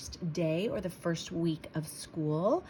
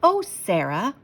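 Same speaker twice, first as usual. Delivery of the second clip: louder gushing greeting; 2 semitones higher, 7 dB louder than usual. Acted. Principal greeting Sarah at the car, gushing. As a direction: gush over her warmly. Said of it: "Missus Burton gushed".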